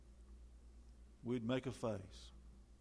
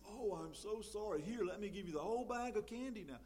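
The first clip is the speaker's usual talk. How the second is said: high, fast, gushing mock mother; mock doting mom; 7 semitones higher than usual. Preacher mimics a mom caving to a pouting kid, gushing and high. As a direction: overdo the fussing mom who gives in. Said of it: "our mom comes and says".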